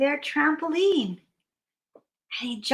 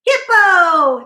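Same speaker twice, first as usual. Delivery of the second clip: loud, raised, falling call; excited greeting; 4 semitones higher, 8 dB louder than usual. Acted. Bear spots arriving Hippo, loud excited call. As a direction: shout the name in greeting.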